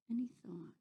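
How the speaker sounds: hushed whisper. A narrator's reading. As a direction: breathe it out softly. Whispered narration.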